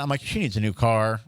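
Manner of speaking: normal talking voice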